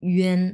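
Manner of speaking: one ইউএন with tight rounded ü; firm, pressured ü-en for ইউএন; pressed pronunciation. Shown as a demonstration. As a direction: push ইউএন through pursed lips as ü-en. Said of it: "মুখে অনেক pressure দিয়ে".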